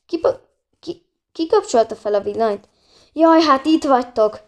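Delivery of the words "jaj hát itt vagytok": relieved exclaim. Acted. Father finds the missing children.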